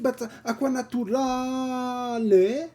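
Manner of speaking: acqua naturale said slowly, high, with a held pause; Italian pause. Shown as a demonstration. Streamer mimicking the Italian pause she can't do. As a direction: stretch out acqua naturale with a gap. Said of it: "Do you make the pause too".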